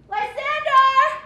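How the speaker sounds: frightened calling. Acted. Hermia finds Lysander gone.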